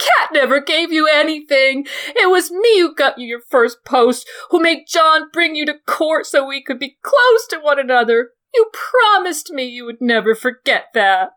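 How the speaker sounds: high, loud, near-tearful jealous pleading; hysterical jealous aunt. Acted. Lysa to Littlefinger over Sansa, high, loud, tearful shouting. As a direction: Lysa begging Littlefinger to choose her.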